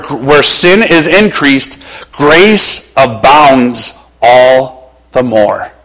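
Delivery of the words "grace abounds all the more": slow, drawn-out grace abounds. Acted. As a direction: stretch grace abounds all the more. Preacher stretches key verse.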